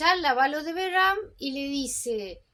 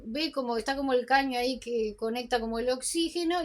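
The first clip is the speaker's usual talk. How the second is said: usual speaking voice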